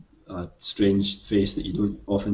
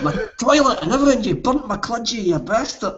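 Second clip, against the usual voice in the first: fast, much higher, over-the-top Scottish; hammed-up Scottish accent; 13 semitones higher than usual. [name] ranting about his toilet in a hammed-up Scottish accent. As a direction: ham up the Scottish rant for laughs. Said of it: "I will gladly ham up the Scottishness".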